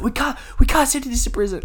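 high, stammering mock protest; flustered teacher. Ex-lawyer teacher refusing to send the notes, acted flustered. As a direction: imitate him tripping over his objection. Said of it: "flustered and shit".

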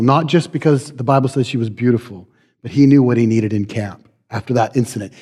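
usual speaking voice

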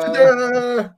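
high showman ta-da; ta-da reveal. Pretend. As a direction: sing out the reveal big.

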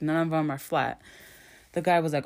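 normal talking voice

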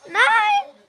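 shout. Real, not acted. Losing a fight in game.

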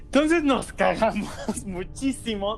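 riéndose mientras cuenta el susto; riendo. Real, not acted. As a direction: suelta una risa a media frase, más lento. Ríe contando el susto. (riéndose de lo asustados que estaban)

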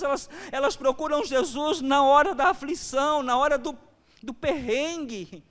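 impassioned preaching. Acted. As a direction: lift the voice and urge the church. Preaching raised pitch.

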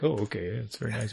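soft, meek acceptance; backing down quietly; imitating the other coaches' soft, meek reply. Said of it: "They're like".

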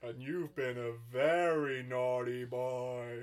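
slow, drawn-out scolding Santa; hammed-up American Father Christmas, swooping in pitch. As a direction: tell off a child as Santa. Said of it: "keep up this accent".

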